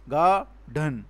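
"Garden" broken into two beats, ga and dhan; "Garden" pulled apart as ga, dhan; split into syllables. Shown as a demonstration. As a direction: say "Garden" syllable by syllable, with a gap between. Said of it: "One is ga and the other is dhan".

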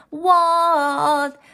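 loud, high, drawn-out reply; calling back. A narrator's reading. Froggy answering mother from the water, loud high call. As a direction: holler it back in a child's pitch.

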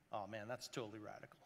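put-on laid-back, fast surfer kid; lazy California dude, quick and low.

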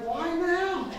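very high comic squeal of disbelief; incredulous. Retold or imitated. Pastor hears friend wants saving after blizzard, incredulous. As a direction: wail it high in mock disbelief.

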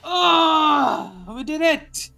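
loud, high, drawn-out victory yells; explosive gamer celebration; triumphant shouting. Real, not acted. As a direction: shout it out as the fight is finally won. Victorious moment in boss fight.

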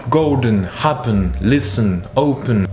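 golden, happen, listen, open, all in the same strong-weak rhythm; stress on first syllable. Shown as a demonstration. Teacher drilling the same rhythm, first syllables stressed. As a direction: punch GOL, HAP, LIS, O, let endings fall. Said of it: "Toujours le même rythme".

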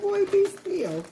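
high slow stretched be still; mom scolding. Retold or imitated. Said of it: "I'm like".

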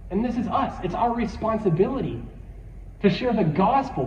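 ordinary delivery

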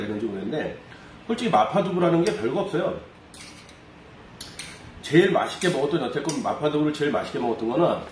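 talking while chewing; eating from a bowl; mouth full of noodles. Real, not acted. Seen 1: Holds small bowl, lifts noodles, chopsticks at mouth, then chews.

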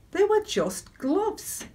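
slow, high, drawn-out punchline; deadpan punchline. Real, not acted. Preacher landing the gloves punchline, slow and high. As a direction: spell out the letdown slowly.

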